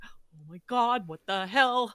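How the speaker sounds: drawn-out, mildly exasperated disbelief; mock dismay. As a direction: act out being left baffled. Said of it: "you're left there going".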